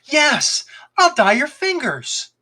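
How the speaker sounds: high, eager exclamation; sharp cry. A narrator's reading. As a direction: blurt it out, suddenly thrilled. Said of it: "the fox let out a sharp cry".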